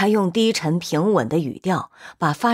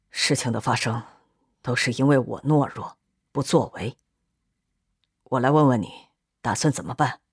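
low, heavy admission; subdued confession; 5 semitones lower than usual. A narrator's reading. Philip admits cowardice to Gino, low and subdued. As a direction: own up gravely, pitch held down.

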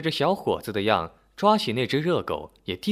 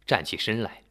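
ordinary delivery

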